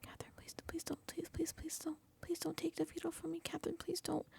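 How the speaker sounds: high, hushed begging in a pity-party voice; mock pleading. Housemate begging Catherine not to take his veto, mock pleading. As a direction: beg softly and high, mocking him. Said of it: "doing the little pity party".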